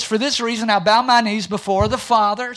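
sermon reading voice; scripture reading. Acted. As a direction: proclaim the verse to the congregation. Reads scripture in a raised voice.

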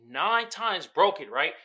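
ordinary talk

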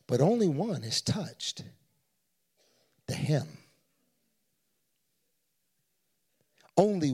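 soft, low, hushed Jesus; quiet voice of Jesus. Acted. Jesus quietly explaining to disciples, soft and low. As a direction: speak it gently as Jesus. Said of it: "He says".

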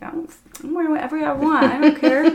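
mock-stubborn reply to the ex-boyfriend, fast and giggling; defiant laughing retort. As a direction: fire it back, laughing at her old self. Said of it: "And I'm like".

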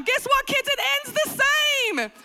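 very high, loud fed-up cry; exasperated parent. Acted. Mother to kids about a rewatched film, shrieking exasperation. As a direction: shout it at the kids, at wits' end.

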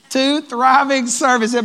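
loud proclaiming. Shown as a demonstration. Pastor retries the goal to rouse a sleepy crowd, loud and slow. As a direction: proclaim it so the room wakes up. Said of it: "I didn't say two services".

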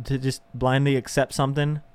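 ordinary talk, nothing marked

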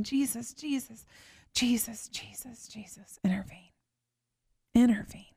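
whispered plea fading away; pleading whispered prayer. Retold or imitated. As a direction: breathe the prayer down to nothing. Reliving a desperate prayer.

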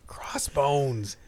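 whispered pun aside. Real, not acted. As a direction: breathe the word as a whisper.

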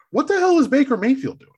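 mock outrage at the QB's performance; incredulous. Real, not acted. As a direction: throw the question up high, exasperated. Exasperated jab at a rival QB.

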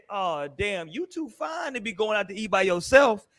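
low, slow retold compliment; toned-down retelling. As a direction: say the pickup line smooth and unhurried. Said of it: "Why are you trying to change the tone".

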